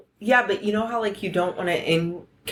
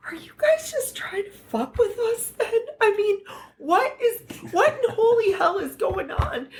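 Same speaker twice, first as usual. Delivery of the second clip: much higher, half-whispered through laughter; laughing panic; 12 semitones higher than usual. Retold or imitated. Retold panic at border interview.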